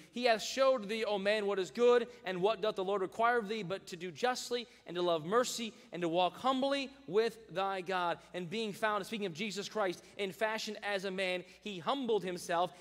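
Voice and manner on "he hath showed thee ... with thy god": loud, high preaching read; fiery sermon reading. Acted. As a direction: proclaim the verse with force. Preaching scripture at full force.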